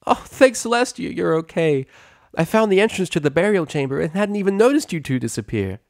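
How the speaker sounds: put-on high, loud relieved young voice; relieved young mare. Daughter hugs her mother, high relieved young voice. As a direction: gush with relief as you hug.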